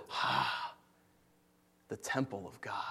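hushed gasp of reverence; awed whisper. Acted. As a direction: breathe it out in wonder. Israel's awed response, acted.

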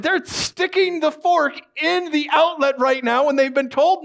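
high-pitched, hammed-up disbelief; exasperated outburst. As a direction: protest wildly as if pointing at idiots. Acted exasperated protest about judging others.

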